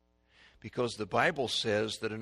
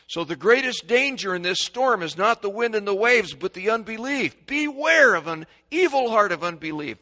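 high, loud warning to the congregation; raised, emphatic pulpit voice; full-force preaching; 10 semitones higher, 12 dB louder than usual. Acted.

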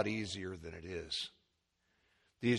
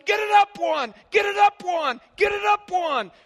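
high, loud shout to a fallen wrestler; yelling at a wrestler; 11 dB louder than usual. Retold or imitated. Preacher retells cheering for Juan, shouting. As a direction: scream it from the stands. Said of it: "this guy's yelling".

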